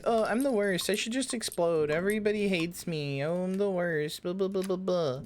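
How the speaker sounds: high-pitched mock whine of self-loathing; mocking self-pity. Streamer imitating self-deprecating chatters, mocking. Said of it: "they'll be like".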